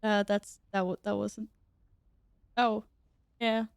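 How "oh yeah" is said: usual speaking voice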